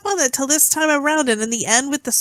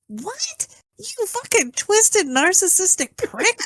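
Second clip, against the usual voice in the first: higher-pitched, drawn-out indignant retort; mock outrage; 6 semitones higher, much slower than usual. Viewer retorting to the Goblin King's speech, indignant. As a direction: replay your outrage at the villain, pitched up. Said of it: "I was very angry".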